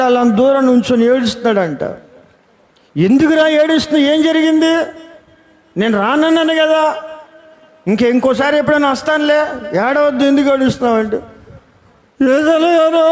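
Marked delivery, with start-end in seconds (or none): 3.0-11.0 put-on coaxing a crying boy. 12.2-13.0 put-on crying boy. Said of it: "అని ఆడు ఏడిచి".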